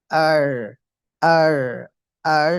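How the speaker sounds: আর said loud and drawn out, three separate times; model আর. Shown as a demonstration. Teacher models the letter R for students, loud drawn-out আর. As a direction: sound out আর firmly for learners to copy. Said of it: "উচ্চারণটা এরকম হবে".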